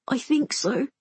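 put-on high, little child's voice; timid young brother; small boy. A narrator's reading. Frightened young brother answers.

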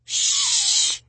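long shushing hiss; hushing shush. Acted. Grover cutting off his friend to listen, drawn-out shush. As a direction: hush them with a drawn-out breath.

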